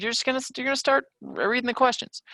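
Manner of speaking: high, fast mock of a rushing student; playfully parodying a student who skims. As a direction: rattle it off like a panicked kid. Teacher mimics a student rushing in.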